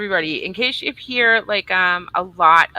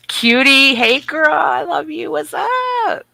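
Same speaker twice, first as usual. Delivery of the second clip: much higher, bright squealy hello; gushing greeting; 10 semitones higher, 4 dB louder, slower than usual. Real, not acted. Greeting Cutie in the chat, high gushing squeal. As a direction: squeal a hello at a favorite chatter.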